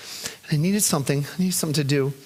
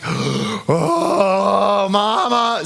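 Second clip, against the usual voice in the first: put-on crying toddler; 3 semitones higher, much slower than usual. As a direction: whimper for mama like a toddler. Crying child sees mom.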